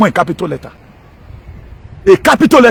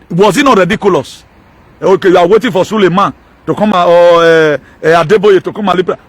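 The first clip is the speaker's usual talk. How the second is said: ordinary talk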